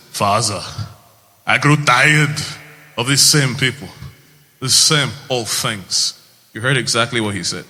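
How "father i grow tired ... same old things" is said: put-on thick Austrian-accented movie line; strong accent, weighty delivery of a Hercules line; Arnold Schwarzenegger. Hercules speaking to his father, thick Austrian accent. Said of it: "what he sounds like first in the movie".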